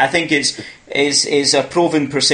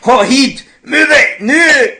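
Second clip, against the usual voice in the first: much higher and louder Scottish bellow through laughter; Scottish movie quote; 12 semitones higher, 7 dB louder, slower than usual. Retold or imitated. Host joins guest quoting the film, laughing Scottish yell. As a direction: laugh out the famous Scottish line, hammed up. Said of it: "I've seen So I Married an Axe Murderer".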